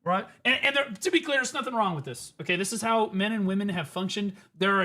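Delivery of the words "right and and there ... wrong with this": ordinary talk, nothing marked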